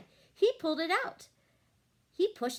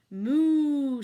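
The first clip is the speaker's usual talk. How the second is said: loud drawn-out moo; cow moo; 9 dB louder than usual. A narrator's reading. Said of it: "said Cow".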